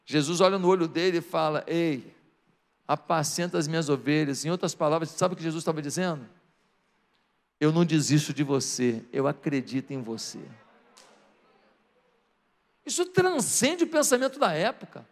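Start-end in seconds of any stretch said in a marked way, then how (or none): 1.7-4.5 low, soft, tender charge; gentle reassurance. Acted. Jesus restoring Peter after his confession, gentle and low. 7.6-10.4 very low, soft and sincere; tender reassurance. Acted. Jesus telling Peter he still believes, hushed tenderness.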